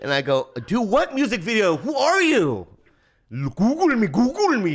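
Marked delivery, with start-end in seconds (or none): 0.7-2.7 high, loud mock outrage; incredulous. Retold baffled reply to caller. 3.3-4.7 high, loud imitated Korean accent. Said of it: "he says".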